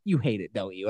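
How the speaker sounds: put-on low, soft deflated kid; crestfallen. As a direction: imitate a let-down boy quietly. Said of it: "his whole face just drops".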